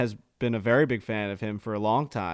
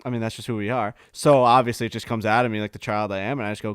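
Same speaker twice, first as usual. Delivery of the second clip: ordinary talk, nothing marked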